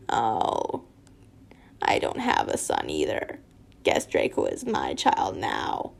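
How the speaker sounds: low raspy villain whisper; deep and raspy. Acted. Voldemort taunting Lucius about taking Draco. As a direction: hiss it like a dark lord. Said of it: "it said deep and raspy, and that's my deep and raspy voice".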